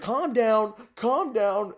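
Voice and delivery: high, loud, pleading calm down; urgent calming. Retold or imitated. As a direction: urge him to calm down, shouting. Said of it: "She's like".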